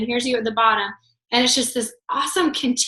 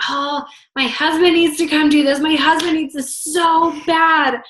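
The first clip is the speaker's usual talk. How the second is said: higher, pleading wife, slowing down; 6 semitones higher, 4 dB louder than usual. Retold or imitated. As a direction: beg like a wife who wants this for her husband. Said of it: "they're like".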